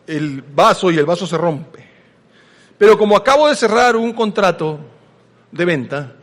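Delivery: normal talking voice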